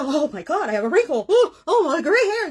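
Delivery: high, loud hammed-up alarm over a wrinkle; mock panic about aging. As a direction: play up the vanity panic, cries of oh rising sharply. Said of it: "We go".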